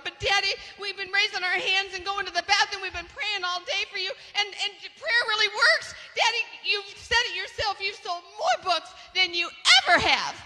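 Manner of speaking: put-on high, earnest child pleading; a daughter explaining herself to her dad; pleading girl. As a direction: plead with your father like a little girl.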